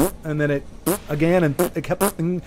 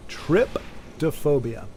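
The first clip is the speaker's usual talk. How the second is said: slow drawn-out word; 2 semitones lower, 6 dB quieter, much slower than usual. Shown as a demonstration. Streamer savouring the word Tryptophobia very slowly. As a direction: stretch Tryptophobia out very slowly, syllable by syllable. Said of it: "is what it is".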